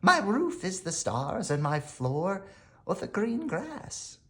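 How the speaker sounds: a gentle wanderer describing her life; a touch of a dreamy stranger; lightly voiced woman. A narrator's reading. Woman explains her outdoor life.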